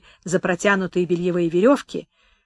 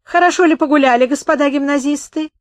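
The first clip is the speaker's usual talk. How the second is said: mocking sleuth; 7 semitones higher, 5 dB louder than usual. A narrator's reading. Sleuth taunting the schoolboys.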